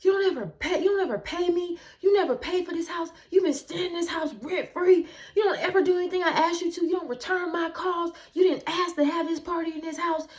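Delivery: high, loud, rapid complaining rant; mock angry realtor. Realtor scolding the narrator tenant, mock angry high rant. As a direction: imitate a furious landlord. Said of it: "The realtor is like".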